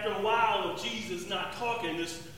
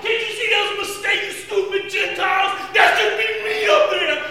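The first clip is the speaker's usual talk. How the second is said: high, loud, desperate yelling; anguished shouting; 9 semitones higher, 11 dB louder than usual. Retold or imitated. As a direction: scream at the soldiers to take him down. Yelling at soldiers to stop.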